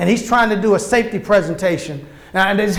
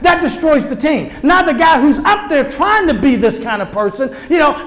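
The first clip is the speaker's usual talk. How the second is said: ordinary talk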